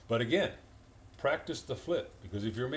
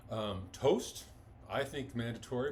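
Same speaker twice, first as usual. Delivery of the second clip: ordinary talk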